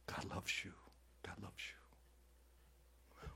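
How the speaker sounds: "God loves you" fading to a slow, far-off whisper; mocking the soft message people want, whispered away; mock soothing whisper. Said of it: "You just wanna hear".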